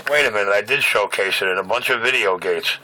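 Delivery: ordinary talk, nothing marked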